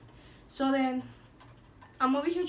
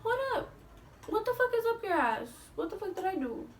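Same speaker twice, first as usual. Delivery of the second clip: mock outrage; 7 semitones higher than usual. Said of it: "And I'm just like".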